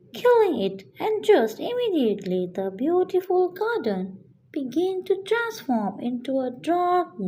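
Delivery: usual speaking voice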